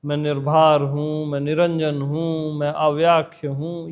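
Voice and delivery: low, measured mock-mystic chant-like listing; mock spiritual recitation. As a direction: recite lofty titles in a low, even voice. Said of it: "ये सब मिर्च मसाला लगाना है".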